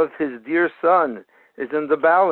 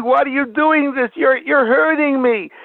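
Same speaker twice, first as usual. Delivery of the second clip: imitated screaming protest; 8 semitones higher than usual. Son screaming at his father during surgery, mild mock scream. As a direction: act out a hurt boy crying out, held back. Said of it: "the son is screaming".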